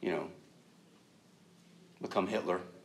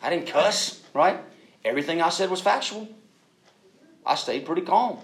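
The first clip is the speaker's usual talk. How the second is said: higher, louder mock defensiveness; self-justifying; 6 semitones higher, 8 dB louder than usual. Husband defending his behavior to his wife, mock self-justifying. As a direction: act out pleading your own innocence.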